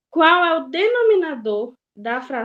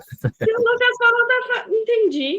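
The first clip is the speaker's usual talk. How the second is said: high mock echo of the student; parroting the kid's line back; mimicking a student; 12 semitones higher than usual. Teacher mimics student's phrase.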